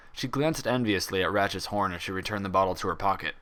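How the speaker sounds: ordinary delivery, nothing marked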